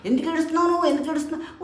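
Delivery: a master baffled by the weeping pupils; puzzled guru. Acted. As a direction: guru questions his crying disciples. Guru asks one boy.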